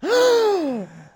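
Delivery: loud, high mock gasp; dramatic gasp. Storyteller reacting for the characters to surprise uncle, hammed-up gasp. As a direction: gasp hugely, in theatrical shock.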